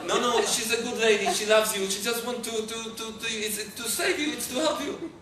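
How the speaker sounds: high, pleading reassurance, stumbling over words; anxious reassurance. Retold or imitated. Father calming his frightened girl at the clinic, over-reassuring.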